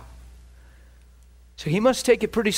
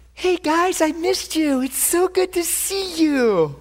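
mock sweet greeting; 9 semitones higher, slower than usual. Mock gentle Jesus, then "Nope.

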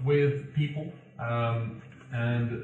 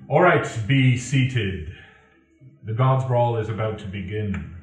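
booming announcement; 7 dB louder than usual. Acted. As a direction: boom it out to the whole arena. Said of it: "deafeningly says".

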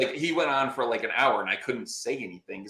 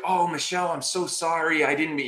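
higher-pitched fawning apology; mock pandering; 6 semitones higher than usual. Other lawyer apologizing to the arbitrator, pandering. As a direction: imitate a lawyer sucking up. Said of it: "totally pandering to her".

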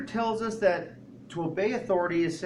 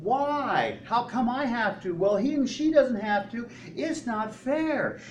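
put-on high whining kid, complaining; whiny child; 6 semitones higher, slower than usual. Child whining at parent, high and complaining. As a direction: mimic a child protesting it's not fair. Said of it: "constantly saying".

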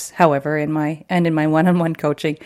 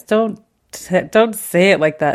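louder, fed-up protest; exasperated teen; 6 dB louder than usual. Retold or imitated. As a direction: roll your eyes and push back. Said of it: "she's rolling her eyes".

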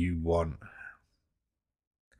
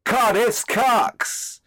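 mock announcement; 11 semitones higher, 4 dB louder, much slower than usual. Mock announcing the award title.

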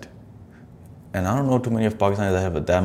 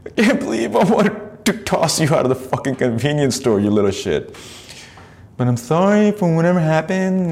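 mock apology; 10 semitones higher than usual. Mocking the apology letter.

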